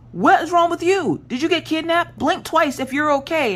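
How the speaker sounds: very high, urgent questioning; high-pitched, worked-up interrogation; retold frantic retort. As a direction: re-enact a panicked daughter grilling her mother.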